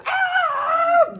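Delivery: high, loud scream mid-air. Retold or imitated. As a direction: yelp as you fly off the jump.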